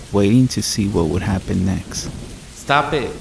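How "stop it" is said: a lightly eerie copy of the cry, higher in pitch; mimicked cry. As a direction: echo the cry back like a creature copying a voice. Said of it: "it mimicked back".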